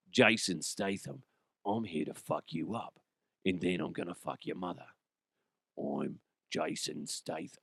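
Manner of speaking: low, soft English tough guy; half-successful quiet action-hero threat; Jason Statham. Acted. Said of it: "I can't do Statham that very, that, that well".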